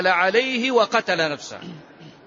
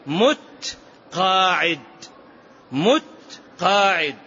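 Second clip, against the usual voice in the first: مت and قاعد said slowly, as two separate words with a pause between; punning split; slower than usual. Retold or imitated. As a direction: break المتقاعد into مت ... قاعد, each word pressed out on its own. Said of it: "أنه كان يقول".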